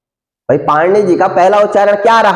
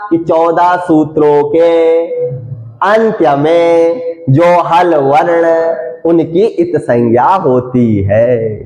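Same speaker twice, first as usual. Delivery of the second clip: slow, stretched phrases sinking lower; slow dictation; 3 semitones lower, slower than usual. Shown as a demonstration. Teacher giving the sutra's meaning to students, slowly dictated. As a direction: spell out the rule phrase by phrase.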